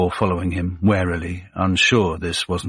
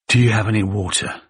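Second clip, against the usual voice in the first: ordinary delivery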